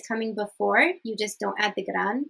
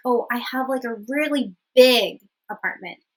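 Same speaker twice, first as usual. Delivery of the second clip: "big" punched as the key word; stress on big; 4 dB louder than usual. Shown as a demonstration. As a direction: make "big" stand out before "apartment". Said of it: "The emphasis, emphasis is on the gran, the big".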